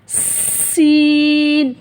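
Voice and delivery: सीन drawn out very slow with a thin sharp s; thin sharp letter. Shown as a demonstration. Teacher contrasts the thin sharp letter for students. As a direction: stretch the s of सीन thin and whistling. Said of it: "सीन सख्ती के साथ बारीक आवाज़ में".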